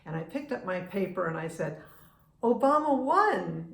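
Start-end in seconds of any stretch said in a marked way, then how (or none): 2.4-3.7 higher, louder, drawn-out retold news; retold triumphant announcement. Traveler tells the kiosk man of the election, triumphant. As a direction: proudly proclaim it, stretching the words.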